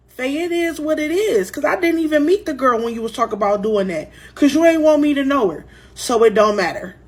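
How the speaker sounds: defensive retort. A narrator's reading. Acted phone quarrel over the wedding.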